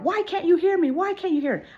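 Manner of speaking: high, mock-indignant repeated demand; agitated date. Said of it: "I'm trying to calm him down".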